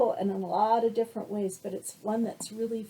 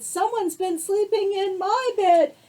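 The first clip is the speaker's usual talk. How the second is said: higher, louder mama bear voice; mother bear, a step up in pitch; high mama bear, stress on my; 11 semitones higher, 9 dB louder than usual. Acted. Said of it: "And Mama Bear said".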